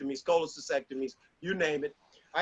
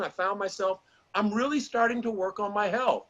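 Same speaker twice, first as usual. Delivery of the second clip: ordinary talk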